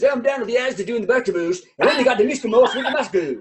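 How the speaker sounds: rapid, garbled mock British patter; British comic's act. British comic doing local references, fast mock British accent. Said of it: "their entire act was local references".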